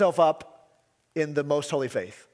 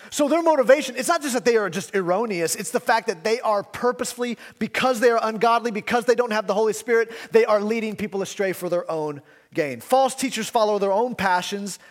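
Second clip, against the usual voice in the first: fiery preaching; 6 semitones higher, 4 dB louder than usual. Acted. Pastor warning the church about false teachers, fiery preaching. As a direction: pitch rising, louder, driving each clause home.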